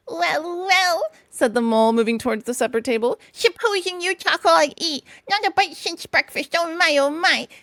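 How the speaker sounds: high, eager little animal voice; a hungry little mole at the table, high. Acted. Mole reaching for supper, high eager voice.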